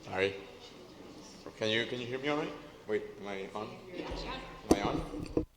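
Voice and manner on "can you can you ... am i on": normal talking voice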